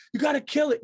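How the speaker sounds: high, loud urgent shout; mock alarm. Friend urging the Italian to kill the zombie, mock alarm. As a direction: pitch way up, shout it in mock panic.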